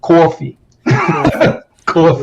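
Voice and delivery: coffee said as kʰɔɹfi with a Jersey vowel; Jersey coffee with an r-colored aw; Jersey accent coffee. Shown as a demonstration. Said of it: "let me hear you say coffee".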